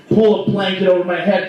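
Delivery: usual speaking voice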